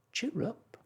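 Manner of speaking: high, bright little bird voice; cheerful bird. A narrator's reading. Lark agrees with Mary Poppins, high and chirpy. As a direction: chirp it like a freed songbird. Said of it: "agreed the lark".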